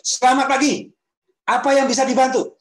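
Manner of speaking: shrill over-eager greeting, far up in pitch; overly high greeting. Shown as a demonstration. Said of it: "Kan beda ya misalnya".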